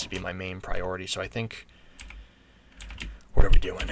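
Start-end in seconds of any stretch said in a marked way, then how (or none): 3.3-3.9 a loud, startled "what are we doing?"; a loud, startled outburst mid-game; sudden shout. Real, not acted. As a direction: blurt it out loud in alarm. Sudden loud outburst while gaming.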